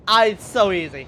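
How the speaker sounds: high, loud burst of glee; triumphant outburst; elated crowing. Real, not acted. Crowing over game pull.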